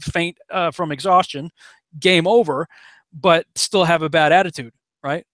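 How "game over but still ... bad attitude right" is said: usual speaking voice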